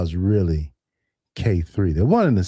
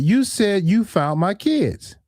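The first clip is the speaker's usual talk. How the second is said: mock alarm; 10 semitones higher, slower than usual. Retold quarrel, own reply.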